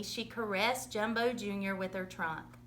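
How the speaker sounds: ordinary delivery, nothing marked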